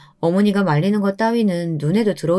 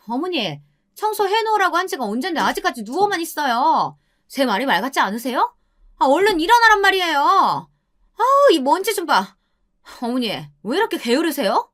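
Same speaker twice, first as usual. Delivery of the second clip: high, shrill-pitched nagging at the mother-in-law; wife yelling; 9 semitones higher than usual. Acted. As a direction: scold her like a spiteful daughter-in-law. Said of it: "아내는 어머니에게 소리를 지르느라". (시어머니를 다그치는 높은 목소리의 며느리)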